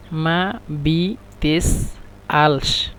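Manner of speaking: very slow, split into syllables; মা বি তেস আলস spelled out in separate slow syllables. Shown as a demonstration. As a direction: break it apart syllable by syllable for learners. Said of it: "একসাথে আমরা বলি".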